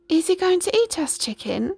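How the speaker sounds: high, worried Jack; fearful boy. A narrator's reading. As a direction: ask it as a scared boy. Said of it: "shaking with fear".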